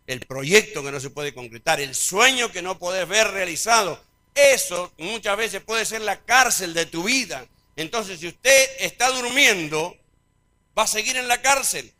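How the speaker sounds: loud, high preacher's climax, slowing at the end; loud, high preaching climax; preaching at full force. Acted. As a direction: build it up and hammer it home.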